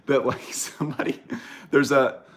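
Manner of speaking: airy high giggle over the words; breathless giggling; laughing. Real, not acted. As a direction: laugh through it.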